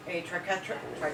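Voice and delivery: mumbled without top teeth; words slurred by a toothless mouth; lips over teeth. Real, not acted. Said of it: "can't talk, no teeth".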